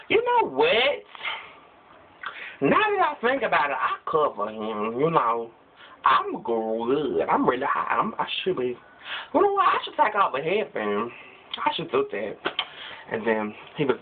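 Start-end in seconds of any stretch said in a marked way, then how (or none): 0.1-1.0 squeaky high burst of an idea; sudden bright idea. Acted. Devil gets a bright idea. 9.3-10.3 squeaky high burst of an idea; sudden bright idea. Acted. Devil gets another idea.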